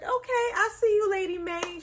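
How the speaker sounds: quick high sassy hype; playful sassy approval; teasing cheer. Retold or imitated.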